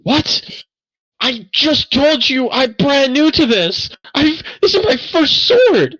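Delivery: very high, slow, exasperated yelling; indignant outburst. Acted. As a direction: protest at the top of your range. Angry smith rejects the suggestion.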